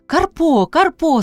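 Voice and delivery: high, excited call of a name; crying out. A narrator's reading. Priska hears Karpo arriving, cries out. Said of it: "скрикнула Пріська".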